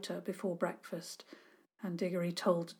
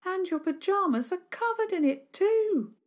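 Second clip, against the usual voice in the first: put-on much higher girl's voice, pointed; young girl accusing; 11 semitones higher than usual. Dora accusing Sarah Milburn in a high girl's voice. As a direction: pipe up as a little girl. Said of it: "Dora pushed through now and said this".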